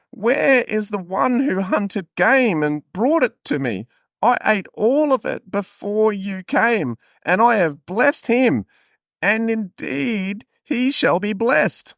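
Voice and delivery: high, slow hammed-up biblical declamation; Isaac, indignant father. Father telling son the blessing is gone, theatrical indignation.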